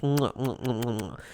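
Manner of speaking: blown kisses. Acted. Thanking a gifter with smacked kisses. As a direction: blow kisses to the screen.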